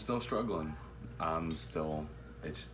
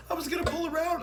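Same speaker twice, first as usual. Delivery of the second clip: mimicking wife; much faster than usual. Mimicking wife's excuse.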